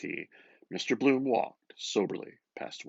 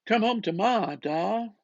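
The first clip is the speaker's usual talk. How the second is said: put-on high, loud child's plea; child calling; 11 semitones higher, 7 dB louder than usual. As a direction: call out like a small girl fetching her father. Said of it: "waiting outside pubs to bring Da home".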